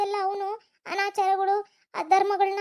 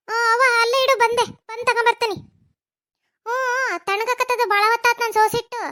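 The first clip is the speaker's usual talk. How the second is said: loud impatient call; 3 semitones higher, 7 dB louder than usual. Acted. Mother shouts to Kavya that the tea has gone cold, loud and impatient. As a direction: fret loudly that the tea is going cold.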